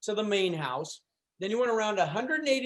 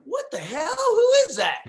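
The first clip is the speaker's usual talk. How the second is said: high-pitched astonished retold reaction; amazed outburst; 11 semitones higher, 5 dB louder than usual. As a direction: blurt it out high, as if stunned. Acts out amazed first reaction.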